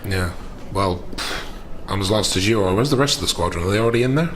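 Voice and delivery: ordinary delivery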